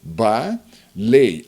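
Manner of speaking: teacher breaking balei into ba and lei; syllable by syllable. Shown as a demonstration. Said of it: "veja bem, olha lá, ó".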